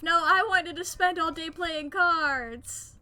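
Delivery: loud, slow, drawn-out whine; frustrated wail. Real, not acted. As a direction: wail it like a sore loser. Whining over lost card game.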